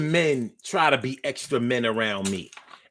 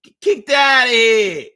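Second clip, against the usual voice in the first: Tommy from Martin; 7 semitones higher, 4 dB louder than usual. Retold or imitated. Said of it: "Russell gets to say, like Tommy".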